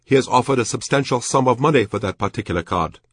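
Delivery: high, earnest voice of a Japanese officer; Japanese collector. A narrator's reading. Telling the shopkeeper of a card he lacks, high-pitched character voice.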